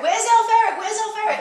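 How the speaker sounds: put-on the children pester about the elf; kids' eager question. Kids asking for the elf.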